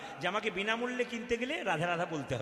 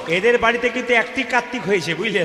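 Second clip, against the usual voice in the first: loud call; 12 dB louder than usual. Real, not acted. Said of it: "calling out to crowd".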